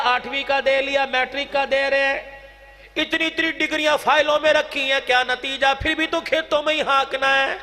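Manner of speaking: ordinary delivery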